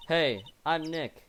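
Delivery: slow and loud. Shown as a demonstration. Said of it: "Maybe I'm not saying it loud enough".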